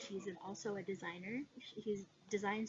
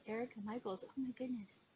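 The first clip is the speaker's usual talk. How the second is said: ordinary delivery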